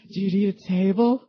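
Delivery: lightly voiced polite stranger; a hint of an eager helper asking; quoting the guy. Comedian retelling a stranger's question, lightly voiced. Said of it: "And the guy's just down there".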